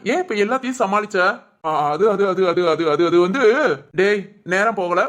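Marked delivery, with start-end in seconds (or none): 1.7-3.4 low, repeated false starts; stammering evasion. Acted. Friend asked why he covered up, low stammering stall. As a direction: stall on the same word, searching for an answer.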